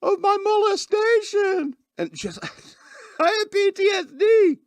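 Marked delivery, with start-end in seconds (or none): 0.0-1.7 mock scream. Host mimicking a girl screaming at her arrest, mock screech. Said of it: "and she was screaming". 3.2-4.6 high, louder hammed-up screech; mock scream. Host mimicking the arrested girl's claim, high mock scream. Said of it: "and she was screaming".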